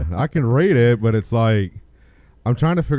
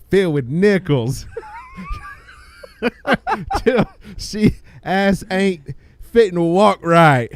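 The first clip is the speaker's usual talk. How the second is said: laughing; 6 semitones higher than usual. Real, not acted. Reading absurd post, cracking up.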